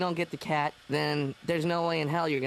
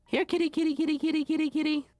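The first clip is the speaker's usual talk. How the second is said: calling a lost pet; faster than usual. Acted. Calling the hiding cat.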